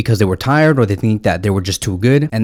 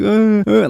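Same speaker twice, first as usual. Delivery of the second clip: high mock sob, much higher pitch; whimpering like a crying player, hammed-up; imitating crying; 12 semitones higher than usual. Mocking Jordi Alba crying at halftime, hammed-up mock sob. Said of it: "He was like".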